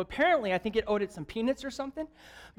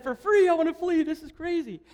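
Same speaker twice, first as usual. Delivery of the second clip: mock panic; 7 semitones higher, 3 dB louder than usual. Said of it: "And I said".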